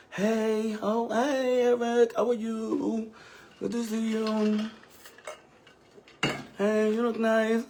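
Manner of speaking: high, sugary fake-nice voice; mock overly sweet hello; fake sweet greeting. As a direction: greet her with exaggerated fake politeness. Fake-friendly greeting to disliked Eric.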